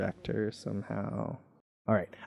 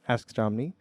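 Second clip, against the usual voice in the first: ordinary delivery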